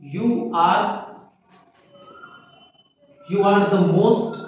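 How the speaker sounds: broken into chunks with long pauses; teacher reading out an example sentence in pieces; word-by-word dictation. Shown as a demonstration. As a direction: dictate the example so they can write it. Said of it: "एक sentence दिया गया".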